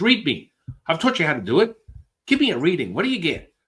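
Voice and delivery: high, loud, eager demand; demanding teacher. Retold or imitated. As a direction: push him to read you now.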